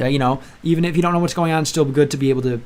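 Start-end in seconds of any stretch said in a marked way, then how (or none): none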